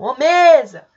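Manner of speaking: loud hail with a long first syllable in "mesa"; drawn-out, intense call to someone far off. Shown as a demonstration. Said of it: "Se você chamar a palavra mesa".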